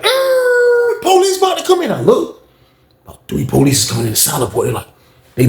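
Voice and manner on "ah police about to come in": very high drawn-out holler; retold warning cry. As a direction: imitate inmates hollering an alarm.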